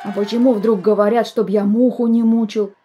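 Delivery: loud, blustering boom; booming bass boy. A narrator's reading. Petrov the second complains about flies, booming. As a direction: boom it out gruffly. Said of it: "забасил Петров второй".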